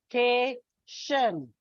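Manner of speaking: "Vacation" slowly split into three syllables; va... ca... tion, slow and separated. Shown as a demonstration. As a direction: sound out "Vacation" with a gap after each syllable. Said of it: "It's a trisyllabic word".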